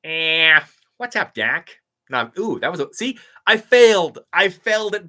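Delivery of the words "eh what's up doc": loud, higher cartoon rabbit greeting; attempted Bugs Bunny, a bit off; Bugs Bunny impression. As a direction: toss off a cartoon rabbit's catchphrase. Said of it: "Do a Bugs Bunny?".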